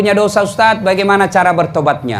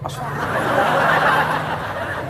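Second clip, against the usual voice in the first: breathy whispered istighfar; 8 semitones higher, 7 dB quieter, much slower than usual. Pretend. Mocking ogling youth.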